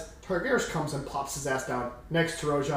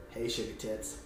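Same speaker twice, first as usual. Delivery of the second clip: low, soft leering line; sleazy flirt; 8 semitones lower, 12 dB quieter than usual. Acted. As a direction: purr it like a creep. Targaris flirts crudely.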